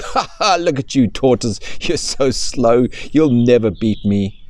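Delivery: high, loud taunting laugh and sneer; mocking cheetah. Cheetah taunting the tortoise, high and mocking. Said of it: "laughing at the tortoise and saying".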